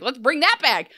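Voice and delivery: high, rallying cheer, speeding up; mock enthusiasm. Townsfolk rallying to bring the club back, mock enthusiasm. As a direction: play an eager crowd pitching a plan.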